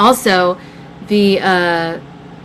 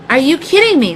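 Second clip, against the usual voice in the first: exasperated disbelief; 7 semitones higher, 4 dB louder than usual. Retold or imitated. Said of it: "I'm like".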